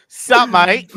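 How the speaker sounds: loud, high mock-Australian greeting; hammed-up Australian accent. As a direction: imitate an Aussie spider, half laughing. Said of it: "the best Australian accent".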